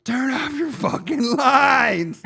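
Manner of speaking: high, drawn-out imitated holler; yelling from a distance. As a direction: mimic a crazed far-off yell. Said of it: "I hear in the distance".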